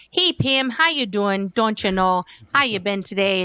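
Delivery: chatty Midwest neighbor voice, hammed up; Midwest accent. Playing Barb greeting her friend, mock Midwest accent. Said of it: "we used to do a whole, like, Midwest thing".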